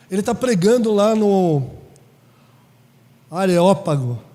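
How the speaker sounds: a loud, fervent sermon push; sermon voice, loud again; preaching. Acted. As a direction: lift it back up to the pulpit.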